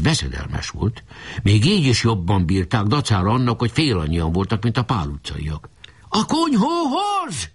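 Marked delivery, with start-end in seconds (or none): 6.1-7.5 very high, drawn-out yell. A narrator's reading. Enemy chief redirects his troops, high drawn-out yell. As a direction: stretch the rallying cry high and slow. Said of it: "üvöltött Ács Feri".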